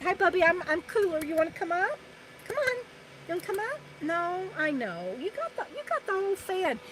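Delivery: soft, high pet talk. Real, not acted. Woman talking to her panting dog, soft high baby talk. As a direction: coo to the dog.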